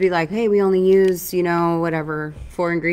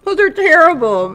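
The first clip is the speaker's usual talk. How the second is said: disgusted outburst; 10 semitones higher, 8 dB louder than usual. Real, not acted. Disgusted by the bar.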